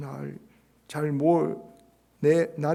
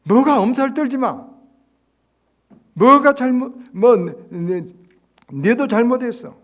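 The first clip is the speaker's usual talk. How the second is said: loud, much higher blaming retort; scolding retort; 10 semitones higher, 12 dB louder than usual. Retold or imitated. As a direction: fire back and blame him. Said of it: "자기 똑똑한 사람들은 금방".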